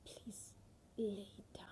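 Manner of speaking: barely voiced please lay down; soft plea. Real, not acted. To the congregation, urging them to surrender: soft plea. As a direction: plead gently, almost silent.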